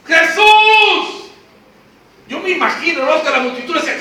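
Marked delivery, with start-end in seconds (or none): 0.0-1.3 high, loud drawn-out call; acted shouting. Blind men calling Jesus through the multitude, shouting. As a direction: cry out over a pressing crowd. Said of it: "¿Cómo le gritaban los ciegos?".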